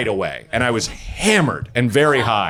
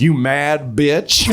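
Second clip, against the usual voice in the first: drawn-out taunt; much slower than usual. Retold or imitated. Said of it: "and she goes".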